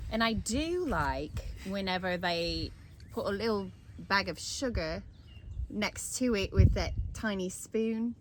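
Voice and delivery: put-on slow, careful British accent; measured British tea-drinker. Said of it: "You do a great British accent".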